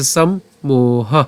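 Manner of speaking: সম্মোহ very slow, split as sam, mo, ha; teacher sounding out সম্মোহ; syllable demo. Shown as a demonstration. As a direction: pause after "sam" in সম্মোহ, then stretch "moha". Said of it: "তাহলে কীভাবে আমরা উচ্চারণটা করলাম?".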